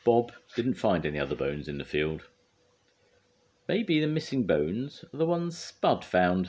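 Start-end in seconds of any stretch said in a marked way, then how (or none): 3.7-6.5 thoughtful, high and slow; piecing it together slowly; Scoop. A narrator's reading. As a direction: muse aloud slowly, high-pitched. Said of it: "said Scoop thoughtfully".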